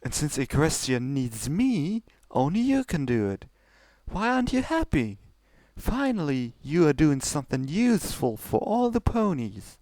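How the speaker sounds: high, regal princess voice pushing her sister; lofty royal sister, coaxing; Celestia's voice. Acted. Older sister pressing Luna to go on, high and regal.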